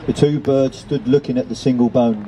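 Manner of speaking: usual speaking voice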